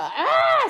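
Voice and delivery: sharp, very high, loud yelp; imitated dog scream. Owner imitating her dog Zaina's frustrated scream. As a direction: mimic the dog screaming in protest. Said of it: "screaming at me".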